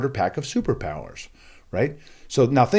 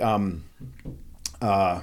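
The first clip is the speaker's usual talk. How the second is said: usual speaking voice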